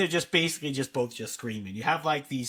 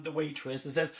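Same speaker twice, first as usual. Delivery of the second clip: ordinary talk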